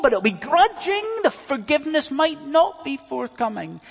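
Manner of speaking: high, loud, pressing sermon delivery; impassioned preaching. Real, not acted. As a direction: preach with rising force, questions pitched high. Preaches at full force.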